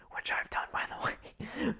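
sheepish whispered confession; whispered aside. Real, not acted. Embarrassed aside about dropping gun.

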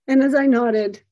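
normal talking voice

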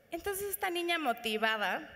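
ordinary delivery

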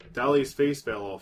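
ordinary talk

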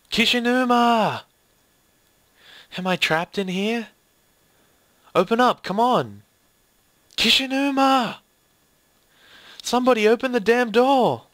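high, loud desperate cries for help; panicked shouting. Acted. Trapped character shouts for Kishinuma to open up, desperate. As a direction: yell through a locked door, pleading.

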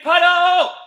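hyped coach call; excited call. Acted. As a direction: rev the kids up. Calling out commands in game.

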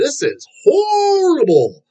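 high, hugely drawn-out outcry; slow, stretched, high-pitched retold disgust; exaggerated dismay. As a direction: wail the verdict in mock horror. Re-enacting his disgusted first reaction.

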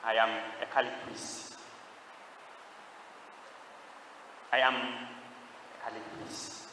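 low, very soft, measured reply; retold priest's answer. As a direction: answer as the priest, quietly and solemnly. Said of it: "Then the voice answers".

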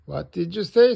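storybook crossness; cross. A narrator's reading. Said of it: "says Mummy Pig crossly".

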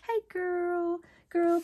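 excited greeting. Real, not acted. Crafter greeting her YouTube friend, high excited hello. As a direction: sing out a happy hello.

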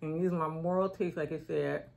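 talking around a stick; chewing stick in mouth. Real, not acted. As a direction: lips parted around stick. Seen 0: Stick held between her teeth through the labelled words, removed later.

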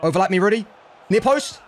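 high, loud shout for the cross; urgent call. Real, not acted. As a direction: yell it at the player. Calling for the cross.

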